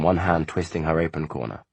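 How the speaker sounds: usual speaking voice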